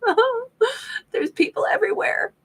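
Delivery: high, strained little cry of panic; overwhelmed squeal. Pretend. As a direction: squeak it out like the crowd is too much. Introvert walking into crowded place.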